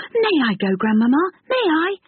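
put-on high begging child voice; eager boy pleading. Boy begs.

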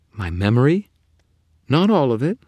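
high, loud startled voices; sharp surprise then teasing; alarmed and amused. Acted. Bargaining exchange.

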